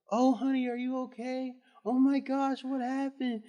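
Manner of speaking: put-on high, sugary concerned old lady; sweet old lady. Student imitating the sweet substitute teacher, high and doting. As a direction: coo like a sweet substitute teacher fussing over him.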